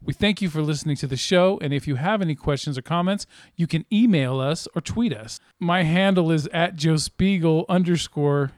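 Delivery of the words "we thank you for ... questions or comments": announcer voice. Acted. Podcast outro read.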